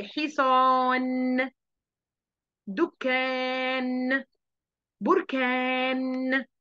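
a teacher drawing out nun-final words very slowly. Shown as a demonstration. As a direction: stretch حصان دكان بركان very slowly. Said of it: "في كلمات آخرها حرف النون".

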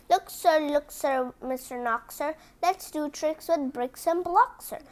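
plain reading voice

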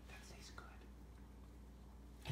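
soft whisper to a dog. Real, not acted. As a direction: whisper it gently.

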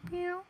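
a very high, squeaky meow; high cat meow. Pretend. Player coaxing the lost cat out, high meow. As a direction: meow up high to lure the kitty.

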